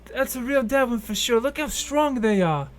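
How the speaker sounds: an excited little elf gawking at the corpse; little elf helper. Acted. Elf helper marvels at the dead beast, high and loud. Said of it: "drops one of the boxes and goes".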